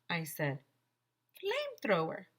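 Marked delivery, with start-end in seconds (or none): 1.4-2.3 put-on delighted kid. A narrator's reading. AJ savors the name.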